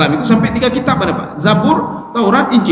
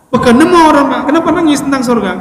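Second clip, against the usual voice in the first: high-pitched, puzzled questioning; acted bafflement; 8 semitones higher than usual. Preacher retells asking the man why he wept, in mock bafflement. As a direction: play someone mildly incredulous, voice pitched up.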